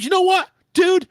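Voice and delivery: loud, high-pitched interruption; heated retort. Retold or imitated. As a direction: cut the boss off, high and loud. Retold retort to upset supervisor.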